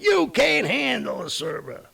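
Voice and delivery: high, slow, drawn-out scoff; mocking disbelief. Real, not acted. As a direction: stretch it out like you can't believe it. Mocking Facebook's servers.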